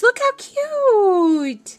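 slow high squeal of cute; delighted squeal. Real, not acted. Showing viewers the gift tags, a slow high coo. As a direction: coo over the tags, drawn out.

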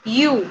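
U said as "you"; English U. Shown as a demonstration. Teacher explaining the English vowel, U as you. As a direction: sound U with a y-glide.

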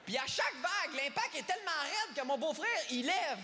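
ordinary talk, nothing marked

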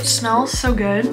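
muffled behind fist; speaking through covered lips; hand over mouth. Real, not acted. Seen 0: Fist pressed to lips from 0.8 to 1.6 while speaking.

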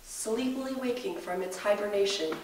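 hushed wildlife documentary narrator; nature documentary. Acted.

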